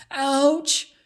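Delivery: sharp, high, loud yelp; sudden hurt cry; cry of pain. A narrator's reading. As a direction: yelp as the rock slices your palm. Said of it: "cried Beatrice, groaning in pain".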